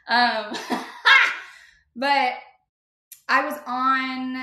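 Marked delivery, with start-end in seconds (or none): 0.6-1.6 a sudden high, breathless burst of laughter; loud airy laugh. Real, not acted. Laughing at her own story.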